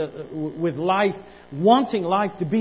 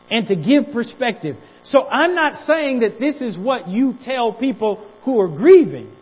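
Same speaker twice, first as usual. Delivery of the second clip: higher, louder preacher's cry, slowed at first; full-force preaching; 7 semitones higher, 7 dB louder than usual. Acted. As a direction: preach it out, building.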